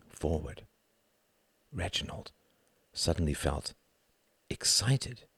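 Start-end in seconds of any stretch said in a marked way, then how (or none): none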